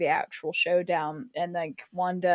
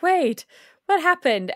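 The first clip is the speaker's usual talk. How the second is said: confused surprise; 8 semitones higher, 4 dB louder than usual. Retold or imitated. Confused viewers reacting.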